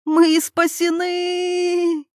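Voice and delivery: very high, long-drawn cheer; jubilant cry. A narrator's reading. Irina seeing the highway, jubilant cry. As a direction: cry out in rapture. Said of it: "закричала Ирина".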